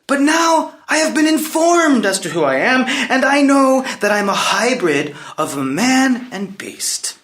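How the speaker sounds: high, forceful proclamation; defiant outburst. Acted. Prince claims his crown against the king, defiant outburst. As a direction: throw it at him, pitch soaring.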